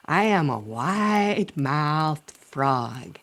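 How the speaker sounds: very slow, stretched-open vowels; wide-mouthed frog. Acted. Proud frog introducing himself in a joke, wide-mouthed and drawn out. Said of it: "trying to open my mouth really wide".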